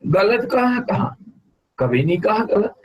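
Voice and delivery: high-pitched indignant denial; real protest. Real, not acted. Guru answers student who says he scolds, protesting. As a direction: protest the accusation, voice jumping up.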